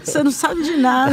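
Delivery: laughing playful tease, easing off; amused teasing. Real, not acted. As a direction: tease him between laughs. Joking together amid laughter.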